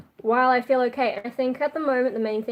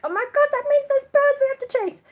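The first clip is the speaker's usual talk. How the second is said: excited dog voice; 6 dB louder than usual. Retold or imitated. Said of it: "they're like".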